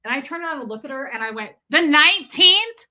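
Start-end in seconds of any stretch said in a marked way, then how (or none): 1.7-2.9 high, loud pointed question; angry shout. Retold or imitated. Client turning to stylist over a loud talker, exasperated shout. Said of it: "I just shouted at you guys".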